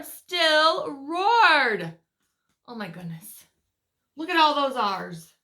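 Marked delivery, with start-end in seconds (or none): none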